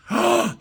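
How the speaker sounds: sharp, audible gasp; horrified gasp. Real, not acted. Telling viewers about a horrific ride, horrified gasp. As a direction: suck in a breath in dismay.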